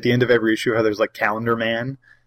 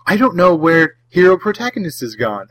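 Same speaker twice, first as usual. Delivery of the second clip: excited; 6 semitones higher, 5 dB louder than usual. Retold or imitated. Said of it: "they'll go".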